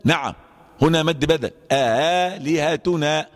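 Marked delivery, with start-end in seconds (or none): 1.7-3.3 slow آلهتنا with the hamza eased; softened hamza. Shown as a demonstration. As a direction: stretch آلهتنا with tas'hil. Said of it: "فيقول هكذا". (آلهتنا بالتسهيل ببطء)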